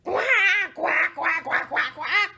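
loud rapid-fire quacking; noisy duck. Acted. Noisy duck answering the animals, loud run of quacks.

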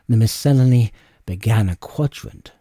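usual speaking voice

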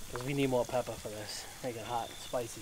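normal talking voice

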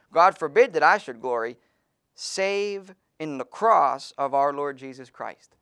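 loud, slowed reading that leans on "cross"; solemn scripture reading. Acted. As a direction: press down on "cross" as the verse lands. Preacher reads the verse again.

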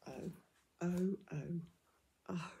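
usual speaking voice

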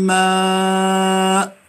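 ماء held very slowly with nasal meem; drawn-out recitation. Shown as a demonstration. Teacher models meem ghunna. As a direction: stretch ماء long after من. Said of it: "لاحظتم؟".

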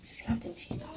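hushed so the seeker can't hear; whispering while hiding. Real, not acted. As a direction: keep it down, she's close.

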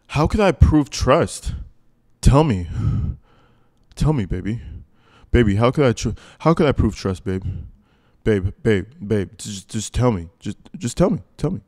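put-on loud, pushy begging boyfriend; hammed-up needy lover calling baby; lightly voiced pushy boyfriend pleading; mock pleading Wade. Voicing Wade's pleading message.

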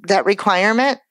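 ordinary delivery

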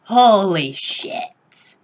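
put-on high, drawn-out amazed teen voice; Spider-Man stunned by Ant-Man growing giant. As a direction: stretch it out in awe as young Spider-Man. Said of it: "And Spider-Man is like".

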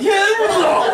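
squealed, rising comic retort; hammed-up panicked squeal; high-pitched mock panic. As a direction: shoot up high in exaggerated alarm.

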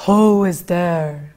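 low, slow demon voice; slow, low menacing demon asking who is there. Acted. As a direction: growl it slowly like a demon. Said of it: "and the demon said".

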